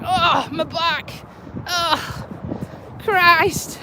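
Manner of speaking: mild drawn-out groan of aches; groaning and moaning. Acted. To viewers about getting up in the morning, mock groaning. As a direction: act out a slow, achy moan. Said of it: "aching and groaning and moaning".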